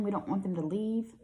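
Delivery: ordinary delivery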